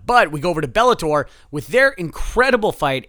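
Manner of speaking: ordinary talk, nothing marked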